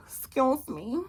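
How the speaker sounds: soft, teasing "Excuse me."; seductive. Shown as a demonstration. Wife coaxing her husband, seductive. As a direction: purr it to draw him in. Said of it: "Real seductive".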